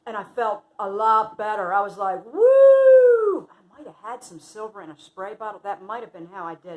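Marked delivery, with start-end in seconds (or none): 2.2-3.5 loud, high "woo"; whoop of relief. Retold or imitated. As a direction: let out a big soaring whoop. Reenacts relief after a big belch.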